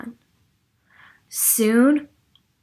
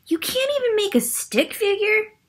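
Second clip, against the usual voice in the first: scornful Ernie; 12 semitones higher than usual. Acted. Ernie mocks Linus's drawing.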